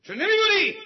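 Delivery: high, very loud angry shout; exasperated. Acted. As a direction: yell it in sudden frustration at the knife. (فریاد از سر لج)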